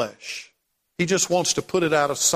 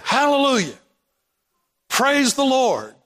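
jubilant praise; 6 semitones higher than usual. Retold or imitated. Retold joyful praise to struggler.

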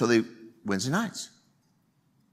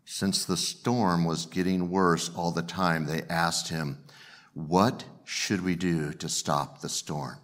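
low, slow, softened Bible reading; hushed, measured scripture narration; 6 semitones lower, 4 dB quieter, slower than usual. Acted. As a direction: read the story out gravely and deliberately. Reads the Bible story.

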